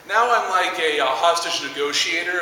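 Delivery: usual speaking voice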